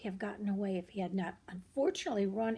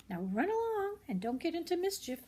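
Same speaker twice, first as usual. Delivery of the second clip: higher motherly send-off; mother rabbit; 9 semitones higher than usual. Acted. Reader as Mrs Rabbit sending the bunnies off. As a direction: shoo them off with a gentle warning.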